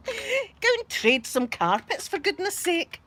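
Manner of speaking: high, drawn-out playful nagging; exasperated order, badly keeping a straight face; mock scolding. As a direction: tease dad in a slow high wail. Mock scolding dad, laughing.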